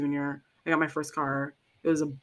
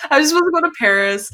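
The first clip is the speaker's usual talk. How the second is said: higher, louder, rushed complaint; whiny lament; 9 semitones higher, 6 dB louder, much faster than usual. Acted. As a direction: wail it like a disappointed kid.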